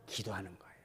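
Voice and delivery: hushed whisper. Acted. Preacher whispering the answer to the congregation.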